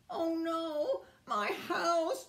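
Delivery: high, acted wail of alarm; yelled dismay. Beaver sees the house collapse, high dismayed yell. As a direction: cry out high, stretching the "no". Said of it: "yelled Beaver".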